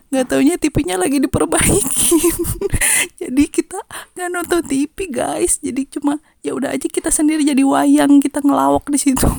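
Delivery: high, loud, talking through laughter; laughing. Real, not acted. Storyteller revealing the TV was broken, real helpless laughter. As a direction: tell the punchline while cracking up. Laughing at her own punchline.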